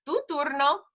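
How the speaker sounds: normal talking voice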